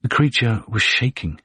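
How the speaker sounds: usual speaking voice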